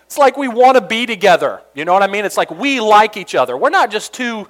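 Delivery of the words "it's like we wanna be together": normal talking voice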